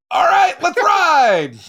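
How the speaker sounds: high, drawn-out rallying shout; rallying cry. Acted. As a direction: call it out big and slow, mock-bold. Bluffing new year, calls out.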